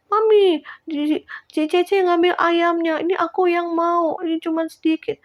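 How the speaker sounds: put-on tattle on your sister like a small kid; whiny little child. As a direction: kid whining to mum about the chicken. Children quarrel over chicken, voiced.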